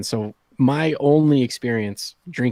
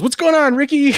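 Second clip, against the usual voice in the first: loud, high, jovial holler; hammed-up cheery cop shout; friendly trooper; 13 semitones higher, 6 dB louder than usual. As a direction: greet an old buddy from the car window. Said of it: "rolls down his window and goes".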